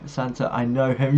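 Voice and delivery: ordinary talk, nothing marked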